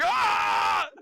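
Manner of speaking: sudden high frightened scream. Pretend. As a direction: shriek as you bolt. Fleeing a ghost, screaming.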